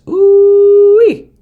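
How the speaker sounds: high delighted ooh-wee; excited squeal. Real, not acted. Admiring clean code.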